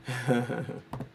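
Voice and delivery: soft, low laugh; quiet amused chuckle. Real, not acted. Amused by wanting to hear "bloody.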